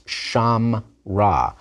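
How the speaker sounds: sham ra split cleanly into two syllables; modern two-syllable pronunciation. Shown as a demonstration. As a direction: say sham ra with the shva dropped, as modern Hebrew does. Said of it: "pronounce it as two syllables".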